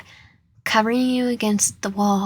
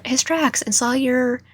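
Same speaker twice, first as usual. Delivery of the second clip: ordinary delivery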